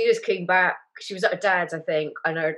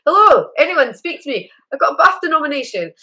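excited calling out; 9 semitones higher, 8 dB louder than usual. Retold or imitated. Calling out for anyone to hear.

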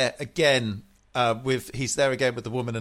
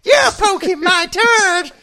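high, louder, slow kiddie voice breaking into a laugh; little kid voice; 10 dB louder, slower than usual. Retold or imitated. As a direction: play a proud little boy, laughing through it. Continuing the kid's joke voice.

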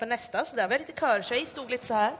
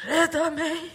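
voiceless plea; 5 semitones higher, slower than usual. Retold or imitated. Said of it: "jag har ju ingen röst".

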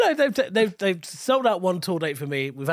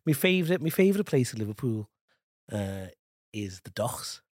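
put-on Liverpool accent, trailing off soft; Scouse accent; 4 semitones lower, 4 dB quieter than usual. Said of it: "can you do your Scouse now".

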